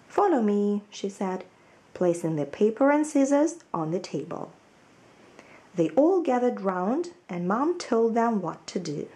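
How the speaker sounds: put-on lively storybook reading for children; story narration. A narrator's reading. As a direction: read it like a picture book to little ones. Teacher reads children's story aloud.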